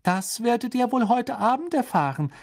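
high-pitched voice with a smile; smiling mother. A narrator's reading. Misses Weasley keeping the secret from her children, smiling. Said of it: "sagte Misses Weasley lächelnd".

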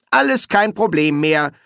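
self-satisfied declaration; proud announcement. A narrator's reading. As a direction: announce it with pride. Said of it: "verkündete Sol stolz".

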